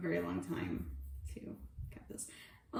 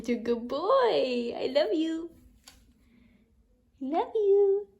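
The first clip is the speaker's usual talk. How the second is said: pet talk; 8 semitones higher, 7 dB louder than usual. Real, not acted. Owner cooing to her cat Binks, high baby talk.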